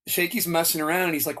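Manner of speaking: ordinary talk, nothing marked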